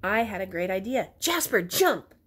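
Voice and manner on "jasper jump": high, excited order; eager, raised-pitch exclamation; urgent command. A narrator's reading. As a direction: call it out to spur a friend on. Hero gives urgent order to friend.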